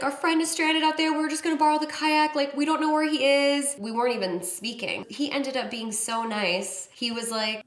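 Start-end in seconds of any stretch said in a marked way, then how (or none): 0.0-3.8 high, hurried mock pleading; put-on frantic, near-crying voice; imitated tearful panic. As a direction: act out a panicking friend explaining herself. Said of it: "panicking and she's kinda crying".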